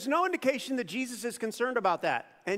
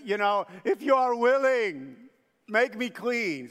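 pleading leper; 2 semitones higher, 6 dB louder than usual. Retold or imitated. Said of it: "he begged him on his knees".

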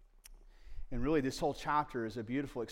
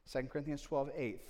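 usual speaking voice

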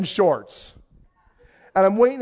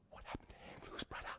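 barely audible, puzzled whisper; whispered aside; 30 dB quieter than usual. Retold or imitated. Visitor turns to his wife in dismay, whispered aside. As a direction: whisper it to your companion.

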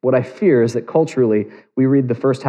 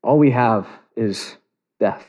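ordinary talk

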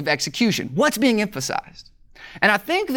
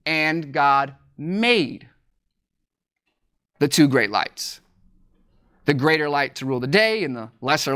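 lightly intoned scripture, lower; scripture reading; 5 semitones lower than usual. Acted. Reads the Bible verses aloud.

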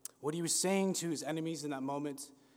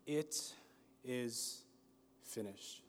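hushed last words; 3 semitones lower, 9 dB quieter, slower than usual. Acted. Jesus's dying words on the cross.

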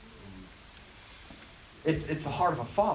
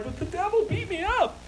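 put-on high, childish whine; whiny complaint; 12 semitones higher than usual. Believer complaining to God about the devil, mock whine. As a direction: whine like a kid telling on someone. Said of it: "we're like".

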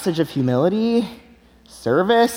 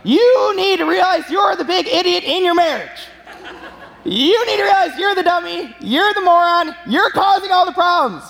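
mock yell; 9 semitones higher than usual. Preacher yelling at a wedding couple, mock shout. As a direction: play the grumpy preacher yelling. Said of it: "he just yelled at this couple".

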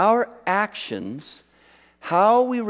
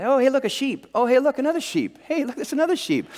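high-pitched, repetitive sightseer chirp; mock tourist; 7 semitones higher than usual. Tourists driving around the South Island, high mock wonder. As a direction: play a tourist pointing out every sheep. Mimics tourists spotting sheep.

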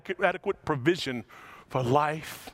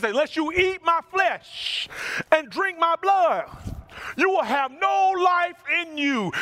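proclaiming; 13 semitones higher, 4 dB louder than usual. Acted. Pastor quoting Jesus to the crowd, proclaiming high and loud. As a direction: declare it like a prophet. Jesus quoted at peak preaching.

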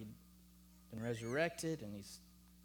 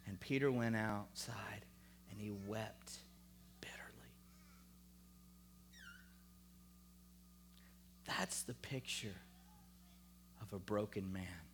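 hushed solemn preaching; 4 semitones lower than usual. Real, not acted. Preacher telling of Peter weeping bitterly, hushed and grave. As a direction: let the sorrow sink in with long pauses.

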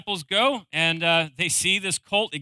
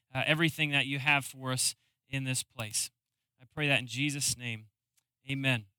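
closing prayer; 4 semitones lower than usual. Real, not acted. Pastor praying for the congregation, hushed and sincere. Pastor prays before the sermon.

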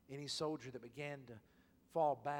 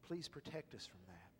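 ordinary talk, nothing marked

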